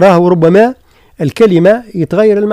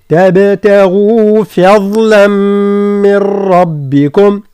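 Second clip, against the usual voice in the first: slow, drawn-out tajweed chant; Quran recitation; 3 semitones higher, much slower than usual. Shown as a demonstration. Teacher recites the verse for students, slow chant. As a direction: recite it long and steady.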